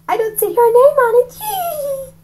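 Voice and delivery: put-on high, teary boy's comeback; high kid voice. Young Rafe answers the bully in a high voice. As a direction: squeak back a cheeky answer. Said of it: "I told him".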